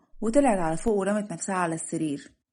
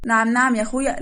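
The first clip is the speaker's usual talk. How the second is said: loud, mock-offended challenge; indignant retort; 3 semitones higher, 6 dB louder than usual. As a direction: take loud, sassy offence. Bride takes offence at teasing. (اعتراض ساخر بصوت عالٍ)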